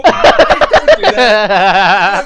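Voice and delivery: laughing refusal. Real, not acted.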